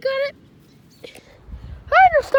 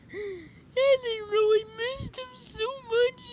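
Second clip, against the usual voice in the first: hammed-up sob over lost friends; fake-cry the words, half joking; play-acted tearful lament; mock crying; 2 semitones higher, 6 dB quieter than usual. Mourning friends in game.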